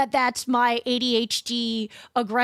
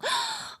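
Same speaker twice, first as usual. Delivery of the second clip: mock gasp; 6 dB quieter than usual. Mock shock at joke.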